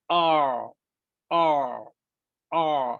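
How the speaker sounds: long, held "آ" squeezed from mid-throat, repeated for students; sustained "آ" sounds with clear gaps between; ain practice. Shown as a demonstration. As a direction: say "آ" as a drawn-out Arabic ain, throat narrowed. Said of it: "اس طرح کہیں".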